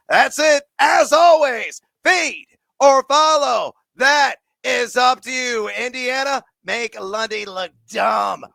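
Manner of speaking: loud, high, slow sign-off shout; hyped sign-off. Real, not acted. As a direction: send them off hyped and loud.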